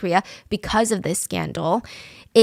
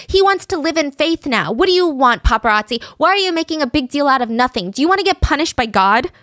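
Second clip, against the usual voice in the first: higher-pitched imitation of an indignant, clucking mom; fussing mother hen; 6 semitones higher, 3 dB louder than usual. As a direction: scold the paparazzi like an overprotective mother. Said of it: "clucking away like some crazy mother hen".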